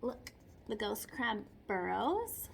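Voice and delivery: ordinary delivery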